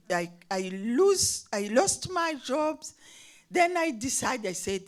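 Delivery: usual speaking voice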